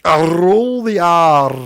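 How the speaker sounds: "roll" with a long, exaggerated rolled R; slow, showy Scottish trill on "roll the R". Shown as a demonstration. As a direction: draw out every R in "roll the R", very slowly. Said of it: "is that rolling the R thing?".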